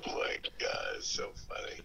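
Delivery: hushed whisper. Real, not acted. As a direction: breathe it in a whisper. Laughing aside to the host.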